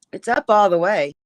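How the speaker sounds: loud, projecting over the line; shouting on bad phone line. Real, not acted. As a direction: push the voice out to be heard. Mic turned up, still checking.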